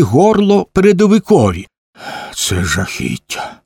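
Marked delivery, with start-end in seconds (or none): 2.3-3.6 low, slow mutter; low grumbled murmur. A narrator's reading. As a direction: mutter it low and slowly, half to yourself. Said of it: "пробурмотів Смок".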